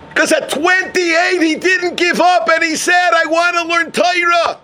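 high, ringing declaration; impassioned preaching. Real, not acted. Preacher celebrates the man who didn't give up, impassioned high declaration.

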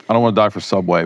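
ordinary talk, nothing marked

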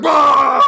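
a high, loud startled shout; mock startled yell. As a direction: yelp like a panicked student. Students yelling at monkey.

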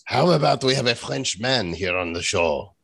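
playful put-on French accent; mock French accent. French jokes with co-host.

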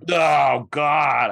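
loud, high groan of horror at a joke; exclaimed dismay. Real, not acted. Guest hearing co-host joke about Rage the musical, loud dismay. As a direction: cry out, appalled but playing along.

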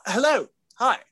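very high, self-conscious hello; awkward greeting. Retold or imitated. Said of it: "This is too awkward".

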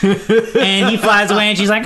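ordinary talk, nothing marked

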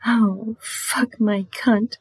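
drawn-out slow plea; slow moaned demand; slow breathy moan. Shown as a demonstration. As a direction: stretch each word out.

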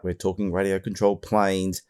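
ordinary delivery

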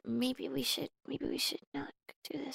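hushed nervous whisper. Acted. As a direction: breathe it out, barely voiced. Toy character voiced in whisper.

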